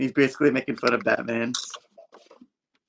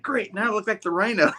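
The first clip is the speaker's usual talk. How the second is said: grumpy hero; 9 semitones higher than usual. Retold or imitated. Thing griping in comic.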